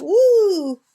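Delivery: high loud hoot; bird call imitation. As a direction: mimic the bird's whoop. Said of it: "[name] says".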